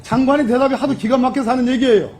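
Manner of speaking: loud heated retort; shouting match. Real, not acted. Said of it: "목소리 낮춰 하세요".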